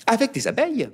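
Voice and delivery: high indignant protest; object, pitched high and huffy; high huffy wife correcting; protesting mother. A narrator's reading. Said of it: "protesta Azalée".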